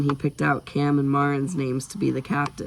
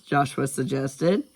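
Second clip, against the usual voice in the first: ordinary delivery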